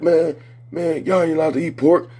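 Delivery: mock accusers. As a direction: imitate the meddlers in a high, fussy pitch. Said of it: "They like".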